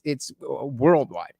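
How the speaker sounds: normal talking voice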